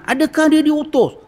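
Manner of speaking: high, sharp, suspicious "Adakah dia diutus?"; harsh interrogation. Retold or imitated. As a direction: grill him like a stern guard. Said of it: "Amboi garangnya". (soal dengan nada garang)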